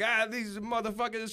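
high-pitched exasperated yell; retold angry rant. Victim calling the case desk back, mock-furious yell. Retold ranting phone call.

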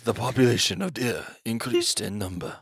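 deep, low nature-documentary narrator; David Attenborough voice. Retold or imitated. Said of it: "The David Attenborough special".

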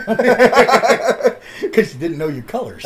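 slow, laughing ribbing; teasing laugh. Real, not acted. Teasing Corey about kindergarten, slow and laughing. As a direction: laugh as you tease him.

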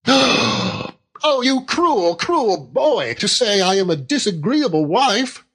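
gasp, then high wounded wail of words; childlike wife, aghast. A narrator's reading. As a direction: accuse him like a hurt child.

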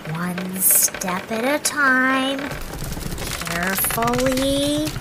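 very slow, careful steps. Pretend. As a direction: tiptoe the words out slowly. Creeping across pretend rope bridge.

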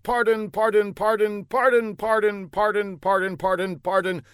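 high put-on voice rattling off pardons; rapid repeated pardons. A narrator's reading. Fla hands the older man his thousand pardons, high and rattled off. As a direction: wearily count out a thousand pardons.

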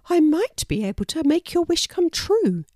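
high-pitched storybook character; high, eager-to-help animal mum; helpful mother wallaby. A narrator's reading. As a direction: offer hope in a high wallaby voice. Wanda offers help in story.

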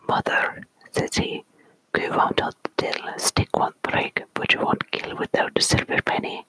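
story poem whispered in a put-on Scottish accent. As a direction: whisper the tale with a playful Scots accent. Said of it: "read it with the proper accent".

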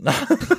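short high burst of laughter. Real, not acted. Laughing at rotisserie joke.

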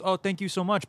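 a hint of a high, polite fighter's voice; Anderson Silva. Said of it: "but in his voice".

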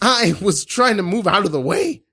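high-pitched, hammed-up wounded wail; pained protest. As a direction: protest as if in agony. Improv injured victim protests.

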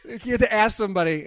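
normal talking voice

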